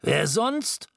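Scrooge voice. A narrator's reading. Scrooge answering.

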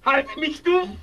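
high, loud panicked plea; terrified plea. Acted. Camille begging Danton to hold him, terrified. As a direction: clutch at him in terror.

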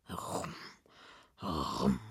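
low, soft "rum", spaced out; noise of the cloth. A narrator's reading. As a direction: imitate fabric being unrolled, deep and quiet. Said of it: "macht der Coupon".

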